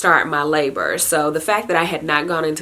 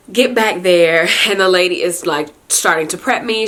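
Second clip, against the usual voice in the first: normal talking voice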